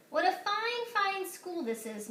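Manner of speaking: lightly voiced proud headmaster; proud principal. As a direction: savour the praise. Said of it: "Mister Keene said".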